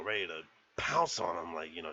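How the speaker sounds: ordinary delivery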